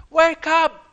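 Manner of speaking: high loud shout. Shown as a demonstration. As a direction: yell it to wake everyone. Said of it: "Shout, that means speaking loudly".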